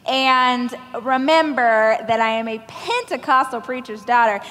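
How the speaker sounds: ordinary talk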